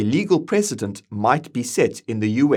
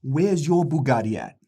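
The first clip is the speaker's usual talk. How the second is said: brash, hyped podcast bro voice; 4 semitones higher than usual. Acted. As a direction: play a swaggering crypto bro host. Bros boasting at each other.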